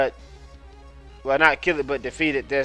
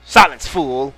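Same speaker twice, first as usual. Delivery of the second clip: loud villain command; slow theatrical villain menace; 5 dB louder, slower than usual. Acted. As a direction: cut him off with villain scorn. Villain snaps at underling.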